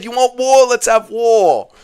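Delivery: high, slow tearful quote; quoting Max, tearful. Retold or imitated. Streamer quoting Max's challenge while upset, tearful. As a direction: mimic the challenge while crying. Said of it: "Max said".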